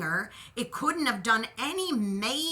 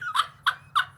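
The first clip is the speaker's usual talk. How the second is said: squealing laugh at herself; high burst of laughter; 5 dB louder than usual. Real, not acted. Laughing at her own screaming fright. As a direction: break into a high giggle.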